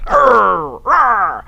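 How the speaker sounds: mock growl of frustration, pirate-like; frustrated growl. Griping about men, a hammed-up frustrated growl. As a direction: grumble a playful Arr of disgust.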